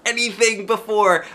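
high, loud squeal; squealing happy child; real squealy. A narrator's reading. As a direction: squeal with joy. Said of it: "I said real squealy".

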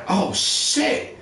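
high-pitched mock dismay; hammed-up shocked reaction; retold alarm. Acting out alarm at the call.

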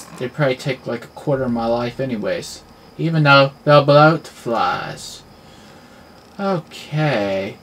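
ordinary delivery

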